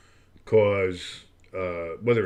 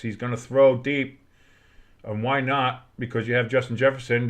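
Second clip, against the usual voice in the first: normal talking voice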